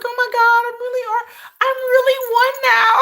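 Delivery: high, loud fangirl squeal breaking into laughter; hammed-up high-pitched thrill; retold excited squeal. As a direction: squeal it as a giddy new fan. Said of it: "I was like, I was like".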